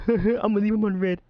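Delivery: quick, high, loud teasing impression; mock a friend ignoring messages; teasing quote. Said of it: "you're just like".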